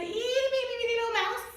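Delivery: sudden high squeak on itty bitty; tiny voice. A narrator's reading. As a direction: make it sound tiny and high. Tiny mouse described, squeaky.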